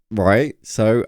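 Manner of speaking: normal talking voice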